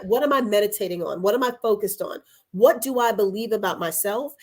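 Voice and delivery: ordinary delivery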